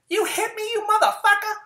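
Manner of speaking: shrieked way up high and loud, as the wife; a hammed-up screaming wife, outraged; mock furious woman. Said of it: "She's gonna pummel your testicles".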